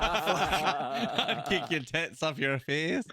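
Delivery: slow mimicking through laughter; laughing, drawn-out imitation; amused mimicry. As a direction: draw out the quoted threat, laughing. Mimics the clip's line while laughing.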